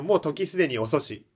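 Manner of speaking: ordinary talk, nothing marked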